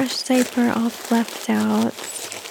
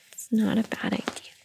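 whisper; 7 dB quieter than usual. Acted. Whispering to baby.

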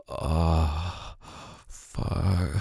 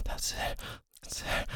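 soft voiceless whisper, much softer; 13 dB quieter than usual. Acted. Lover encouraging partner, in a soft whisper. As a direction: barely breathe the words. Intimate roleplay, whispered line.

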